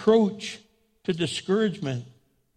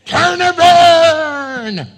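huge drawn-out mock preacher's bellow; hammed-up damnation shout, stretched long; hellfire and brimstone; 9 semitones higher, 12 dB louder, much slower than usual. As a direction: parody a fire-breathing preacher, high and very loud. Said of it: "expecting me to be a hellfire and brimstone".

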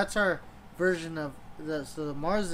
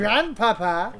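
put-on movie villain German accent; 3 semitones higher, 6 dB louder, slower than usual. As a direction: bellow it in a thick German accent. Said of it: "can you guys do a German accent".

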